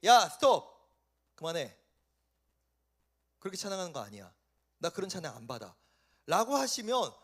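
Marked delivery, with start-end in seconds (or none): 0.0-0.6 loud, abrupt stop command; acted shout to halt; sudden divine halt. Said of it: "하나님께서 갑자기". 1.4-5.8 low, hushed rejection; soft, low acted rebuke; God's stern refusal. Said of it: "하나님께서 갑자기".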